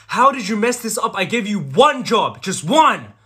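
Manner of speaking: high, loud scolding shout; furious berating. Acted. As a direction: chew out a useless underling loudly. Boss berates underling.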